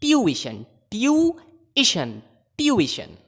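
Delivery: ट्यूशन drilled in three syllables, tyu-i-shan; repeat-after-me ट्यूशन with a clear middle "i"; pronunciation drill. Shown as a demonstration. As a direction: break ट्यूशन slowly into tyu, then i-shan. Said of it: "बोलिए".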